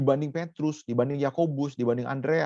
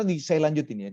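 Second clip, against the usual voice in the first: usual speaking voice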